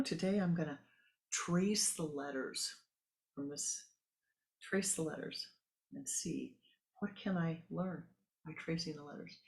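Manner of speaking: ordinary talk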